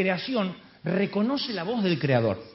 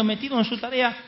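ordinary talk

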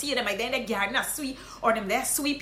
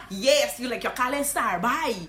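normal talking voice

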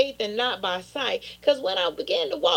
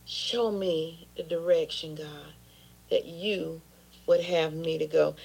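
hushed prayer; 6 semitones lower, 5 dB quieter than usual. Real, not acted. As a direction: drop into a private plea to God. Quiet closing prayer.

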